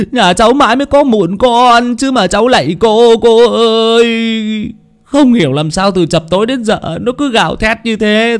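sobbing plea. A narrator's reading. Parents begging the medium to save their only child, high sobbing wail. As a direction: wail and beg, stretching the last words. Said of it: "vái như thế sao mà nức nở".

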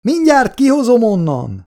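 high, loud shout. A narrator's reading. Scientist shouting down to the trapped soldier, high. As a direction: shout encouragement down the hole.

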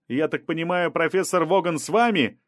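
understated shout; mild shouted question. A narrator's reading. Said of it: "крикнул репортёр".

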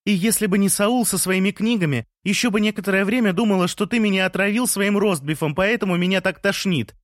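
high-pitched protest; indignant shout. A narrator's reading. Lea breaking into the balcony celebrations, shouting indignantly in a high voice. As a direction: cry out in protest, voice pushed up. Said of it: "закричала Лея".

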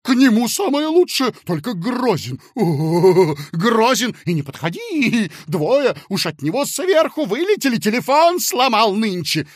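high, loud fearful gossip; frightened fat man. Acted. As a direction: warn him with wide-eyed dread.

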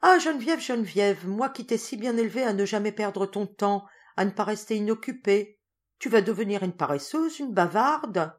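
high, loud playful scolding; teasing governess lament; mock reproach. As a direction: shake your head at her idleness. Primrose scolding Geneviève, head shaking.

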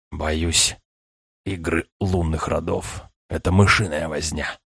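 a hint of a frail old man's whisper; lightly voiced old man, faint and low. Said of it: "прошептал старик".

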